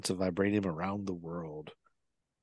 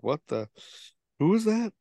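elder's startled reaction; 8 semitones higher than usual. Retold or imitated. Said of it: "you could see the Elder's face".

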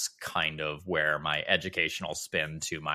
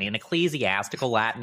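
ordinary talk

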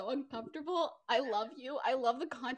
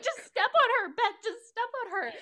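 high, louder, hammed-up tearful urging; mock crying plea; 9 semitones higher, 4 dB louder than usual. Friend voicing Kayla crying in the imagined rain scene. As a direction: plead through pretend tears. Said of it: "she's crying, and she's like".

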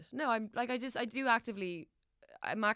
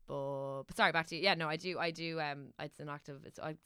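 normal talking voice

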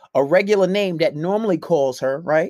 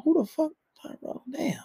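high, hushed disbelief; mock double take; 8 semitones higher, 7 dB quieter than usual. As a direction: squeak out surprise under your breath. Acts out double take at Tyrone.

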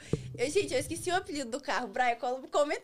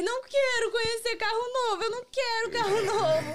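choramingo birrento; 11 semitones higher, 5 dB louder than usual. Retold or imitated. Chorando, recusando carro novo.

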